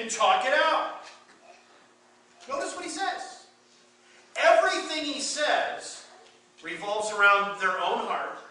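loud, high preacher's push; preaching. Acted. Preacher urging the church to notice Paul's words, loud and high. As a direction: drive the point home to the pews.

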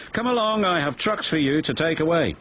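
brisk order. A narrator's reading. Yard manager orders engines along.

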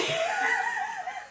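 high shrieking laugh. Real, not acted. Bursts out laughing at a botched take.

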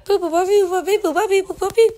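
high, loud robotic boop run; sing-song button-press boops, pitched well up; playful beeping noises. Pretend. Player faking a task, high playful beeps.

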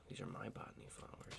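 quick, hushed possessive whisper; very soft, secretive whisper. Pretend. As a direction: whisper it fast, claiming them for yourself. Possessive aside about his flowers.

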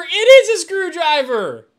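very high, very loud, slow delighted shout; thrilled exclaim. Real, not acted. Streamer showing chat the screwdriver, thrilled shout. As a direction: shout it like you found treasure. Excited discovery.

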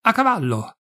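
high-pitched order to mount up; restrained military cry; called command. A narrator's reading. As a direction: call the troops to horse, not full volume. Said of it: "e gridò".

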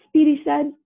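usual speaking voice

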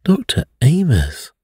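shocked cry of the doctor's name; stupefied cry. A narrator's reading. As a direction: gasp out the name in disbelief. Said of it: "I cried, stupefied".

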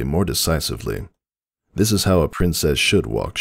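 usual speaking voice

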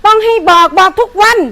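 angry scolding. Acted. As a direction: tear into her, word by word. Owner scolding helper.

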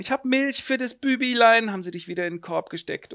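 lightly voiced gossiping landlady. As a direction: coo like a nosy landlady. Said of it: "Frau Wachtel kommt rein".

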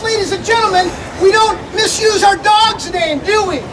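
usual speaking voice

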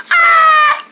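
loud, very high squeal; high-pitched "ah". Acted. Told to go back to normal, loud high squeal. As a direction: squeal the note loudly, far too high. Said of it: "A little bit more deeper".

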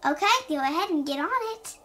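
ordinary delivery, nothing marked

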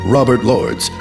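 ordinary delivery

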